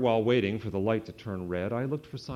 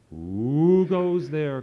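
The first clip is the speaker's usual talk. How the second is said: very slow, louder, higher hooting call; owl's cry; 6 semitones higher, 8 dB louder, much slower than usual. A narrator's reading. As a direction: stretch it out like an owl in the dark. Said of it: "cried an owl".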